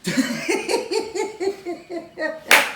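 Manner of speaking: a high burst of laughter, mimicking the actor; imitated big laugh. Retelling the actor's reaction, a mimicked high laugh. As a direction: crack up the way he did. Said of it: "he looked at me and he goes".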